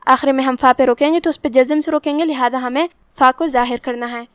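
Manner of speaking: normal talking voice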